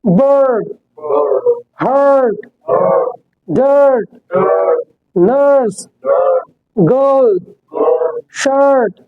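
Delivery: each word alone, long er vowel; slow word drill. Shown as a demonstration. Teacher drills er words slowly for class. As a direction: drill बर्ड, हर्ट with a held er sound. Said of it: "अब कैसे बोलेंगे थे हम?".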